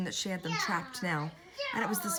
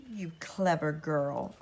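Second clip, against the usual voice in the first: slow hypnotic witch; slower than usual. Acted.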